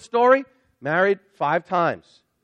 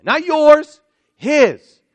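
"Not yours" loud and high, then a hard "His"; stress on His; 8 semitones higher, 12 dB louder, much slower than usual. Real, not acted. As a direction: punch "His" as the answer. Pastor stressing God's name.

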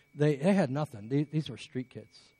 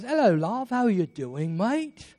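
high, lilting mock British greeting; British accent; 7 semitones higher than usual. British caller greeting the pastor on the phone, imitated British accent. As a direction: put on a cheery British accent, pitched well up. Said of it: "I loved his accent".

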